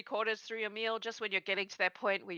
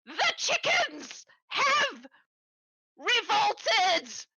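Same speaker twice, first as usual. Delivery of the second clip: high, slow, frantic panicked cry; frantic goblin shout; 12 semitones higher, 4 dB louder, much slower than usual. Acted. Goblin bursting through the door, shouting in panic. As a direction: burst in yelling the news in a panic. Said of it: "he looks a little bit, like, frantic, and he goes".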